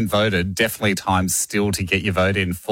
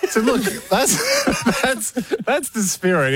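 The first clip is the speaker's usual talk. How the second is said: laughing; 12 semitones higher than usual. Real, not acted. Laughing at cabbie's remark.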